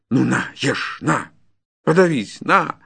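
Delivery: loud, rising angry taunt; cornered peasant lashing out; furious defiance. Acted. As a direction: hurl each word at your tormentor. Peasant turns on forester in fury.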